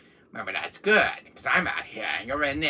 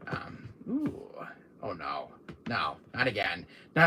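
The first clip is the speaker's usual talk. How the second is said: normal talking voice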